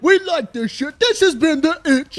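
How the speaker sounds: giddy falsetto goodbye to the viewers; silly high outro voice. Pretend. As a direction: play it as a cartoonish hype host signing off. Goofy outro sign-off voice.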